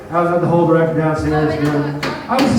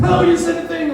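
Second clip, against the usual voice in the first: mock audience reaction; 13 semitones higher than usual. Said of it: "first people were like".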